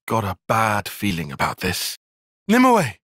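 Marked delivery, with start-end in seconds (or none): 2.5-2.9 sudden high cry of her name; calling out. Acted. Ally cries out to Nimue in alarm, calling out high.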